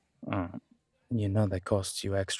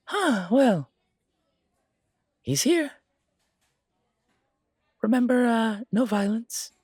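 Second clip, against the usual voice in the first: high, louder, uneasy warning; worried barkeep; 10 semitones higher, 6 dB louder than usual. Acted. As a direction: barkeep bracing for trouble. Trey warns of the rival.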